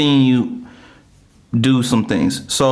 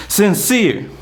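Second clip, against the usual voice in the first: hype introduction; 9 semitones higher than usual. Acted. Host announces guest name.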